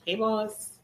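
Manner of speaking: normal talking voice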